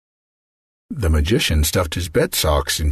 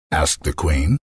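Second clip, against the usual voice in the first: ordinary delivery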